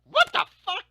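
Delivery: high, loud disbelieving yell; shocked outburst. Real, not acted. Reacting to the brother's elf story with a shocked high yell. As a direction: blurt it out in shock.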